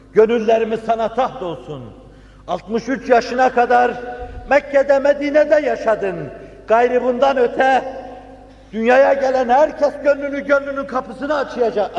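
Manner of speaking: high, ringing, fervent address; impassioned preaching. Acted. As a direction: call out high with full devotion. (coşkulu, yüksek sesle hitap)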